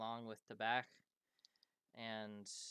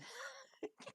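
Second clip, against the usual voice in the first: high, breathless giggle of relief; giddy laugh; 8 dB quieter than usual. Acted. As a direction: laugh out the tension, lightly.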